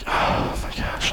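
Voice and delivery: slow, breathy whisper; a dad on the couch whispers to calm himself. Retold or imitated. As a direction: whisper it slowly, letting the anger out. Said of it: "trying to calm down".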